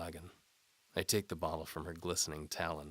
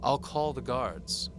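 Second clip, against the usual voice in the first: higher, louder, slow threat; warning; 8 semitones higher, 9 dB louder, slower than usual. A narrator's reading. Antimony warns the knight she'll call guards. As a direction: warn him off sharply. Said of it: "she warns me".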